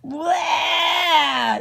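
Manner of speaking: a big grossed-out shout; disgusted yell. Acted. Andrew after the lipstick kiss, loud mock yuck. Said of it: "yelled Andrew".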